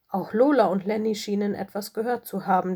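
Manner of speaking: usual speaking voice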